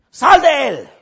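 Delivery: high, loud order to the demon; commanding shout. Acted. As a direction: bark the command out.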